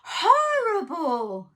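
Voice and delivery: very slow, loud, drawn-out dismay; hammed-up farmyard gasp of dismay; dismayed chorus. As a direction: stretch it out in mock horror. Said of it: "said everyone".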